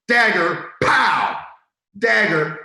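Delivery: comic sound-effect shout; dagger. Real, not acted. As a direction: punch each word like a stab landing. Punchline reacting to Bill's jab.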